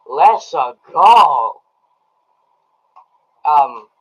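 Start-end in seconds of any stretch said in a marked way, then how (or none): 0.1-1.6 loud hyped-up cheer rising into a big GO; loud, drawn-out rallying shout; excited cheer. Retold or imitated. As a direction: belt it out like a hyped fan, drawing out go. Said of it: "and I'm going".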